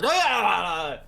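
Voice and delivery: loud, high mock orc scream; retold war cry, hammed up; orc battle yell. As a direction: let out a drawn-out raider's "Ah". Orcs yelling as they attack.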